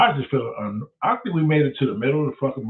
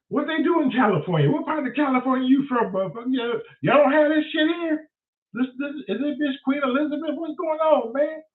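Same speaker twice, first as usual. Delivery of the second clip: incredulous rant; 11 semitones higher than usual. Retold or imitated. Mocking the California girl.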